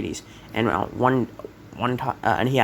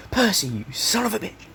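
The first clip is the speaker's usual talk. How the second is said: delighted greeting; 9 semitones higher than usual. Retold or imitated. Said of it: "And he says".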